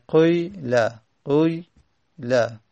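قیله said slowly, first vowel between u and i; teacher slowly demonstrating the ishmam vowel in قیله; ishmam demo. Shown as a demonstration. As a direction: slowly voice قیله with a rounded i, halfway to u. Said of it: "اشمام یعنی بین دمه و کسره هست".

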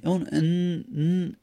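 "ان" then a held "ن"; sounding the tanwin. Shown as a demonstration. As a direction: isolate "ن" as a long hummed n.

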